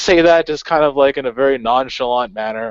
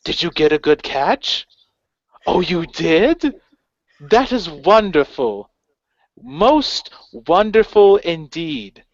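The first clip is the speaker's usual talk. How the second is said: high, slow, patronizing coo; talking to a dog; 6 semitones higher, slower than usual. Retold or imitated. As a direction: praise them like a good puppy. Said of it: "like, a person talks to, like, a dog".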